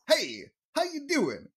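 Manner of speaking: bad comic impression, high-pitched and swooping; hammed-up high-pitched salesman impression; Rodney Dangerfield. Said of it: "that is my horrible impression".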